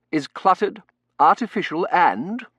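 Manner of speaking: high, loud, emphatic verdict; forceful servant giving his opinion; blunt butler Bain. Acted. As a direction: pronounce a damning critique with full conviction.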